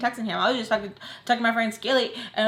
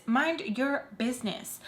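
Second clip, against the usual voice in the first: deliberate rebuke; 5 dB quieter, slower than usual. Retold or imitated. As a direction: spell it out slowly, word by word. Repeats it slowly and deliberately.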